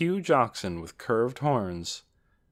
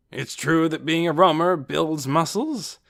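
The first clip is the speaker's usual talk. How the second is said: loud, raised character voice; boastful strongman; 6 semitones higher, 5 dB louder than usual. A narrator's reading. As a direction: brag heartily to the family. Casper boasts of his muscles.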